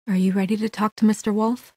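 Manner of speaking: ordinary talk, nothing marked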